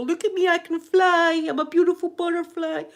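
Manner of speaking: high, held, exclaiming mock voice; tripping person. Imagining herself tripping on mushrooms, high mock-euphoric cry. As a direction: send it up high as if floating away. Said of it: "I'd be the person that would go".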